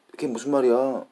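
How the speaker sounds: high, forceful Korean model phrase; exact Korean intonation. Shown as a demonstration. Earpiece telling them what to say, high Korean intonation. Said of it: "how to say it with the exact".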